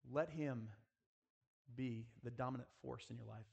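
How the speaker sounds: usual speaking voice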